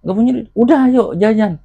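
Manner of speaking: high, loud retort; mama's scolding retort. Retold or imitated. Mama answering her penniless child, high scolding retort. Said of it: "Kalau diomelin nggak usah".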